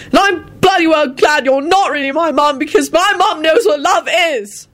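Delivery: high-pitched angry tirade; fierce. Acted. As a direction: spit it out hotly, drawing out the end. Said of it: "he finished fiercely".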